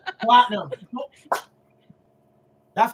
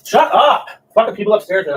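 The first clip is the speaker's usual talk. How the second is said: loud angry shout; 9 dB louder than usual. Real, not acted. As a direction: holler furiously at the neighbours upstairs. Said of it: "this is just the drunk yelling".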